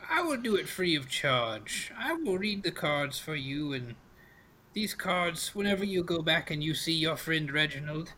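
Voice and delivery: put-on knowing old witch with a lilting delivery; fortune-teller crone. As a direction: make the offer as a sly old card reader.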